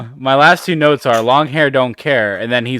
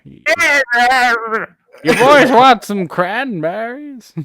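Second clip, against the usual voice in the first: put-on high, slow old man voice; creaky old geezer croaking; 11 semitones higher, 3 dB louder, slower than usual. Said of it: "old man Joker laugh".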